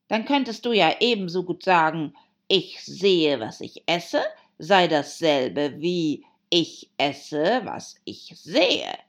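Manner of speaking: loud, emphatic Hatter; Hatter, contradicting. Acted. Hatter lecturing Alice on her logic, emphatic. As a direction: argue it out forcefully, word by word.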